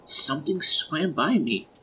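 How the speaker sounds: high, loud, drawn-out alarm; spooked, pitch shooting right up; frightened cry. A narrator's reading. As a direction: cry out as if something brushed past. Wife frightened in dark floodwater.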